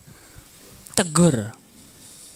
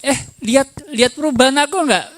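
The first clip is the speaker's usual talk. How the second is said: higher, quick fishing question; retold eager question, pitched up; imitating expectant wife; 8 semitones higher, faster than usual. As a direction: playfully imitate a wife seeking notice.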